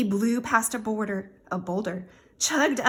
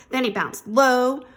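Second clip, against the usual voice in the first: ordinary delivery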